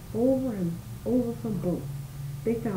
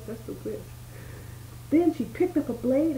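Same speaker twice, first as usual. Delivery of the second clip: plain reading voice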